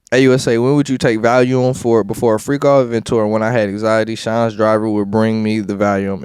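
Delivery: loud, steady courtroom read-out; transcript reading. Acted. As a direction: read the testimony out like a clerk. Reads court transcript aloud.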